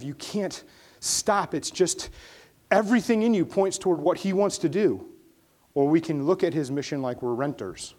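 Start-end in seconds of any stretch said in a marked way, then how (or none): 0.0-5.1 building passion. Acted.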